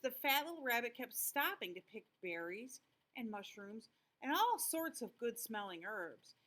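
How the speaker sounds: plain reading voice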